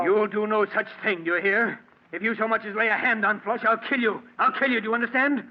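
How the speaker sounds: high, loud enraged threats; furious shouting. Acted. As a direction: yell the threat at her, rising in fury. Threatens wife over the dog.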